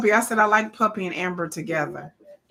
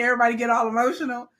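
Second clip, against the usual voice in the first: ordinary talk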